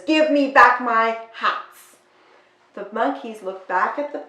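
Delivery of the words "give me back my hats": angry hat seller. Acted. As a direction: bellow it like a cross peddler. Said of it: "he points his fingers and he says".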